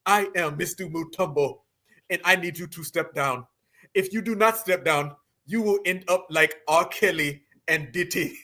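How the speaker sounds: low, lightly put-on Nigerian accent; half-hearted Nigerian accent imitation, ending in a laugh; mock Nigerian accent. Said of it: "So you see exactly what he's doing".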